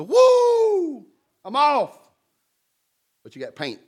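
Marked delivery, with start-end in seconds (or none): 0.0-2.0 high whooping cheer then a quick exit; gleeful whoop. Retold or imitated. Set free by the judge, a high gleeful whoop. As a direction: whoop like you just got off free.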